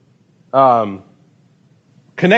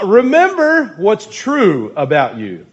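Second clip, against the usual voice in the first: slow emphasis; 5 semitones higher, slower than usual. Real, not acted. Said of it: "preaching emphasis".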